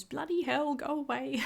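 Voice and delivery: high mock British accent. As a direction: ham up an over-the-top Englishwoman telling him off. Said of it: "And she goes".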